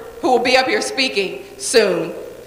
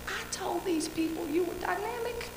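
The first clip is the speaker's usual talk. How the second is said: hissed inner thought; 6 semitones higher, 19 dB quieter than usual. Retold or imitated. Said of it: "I was like".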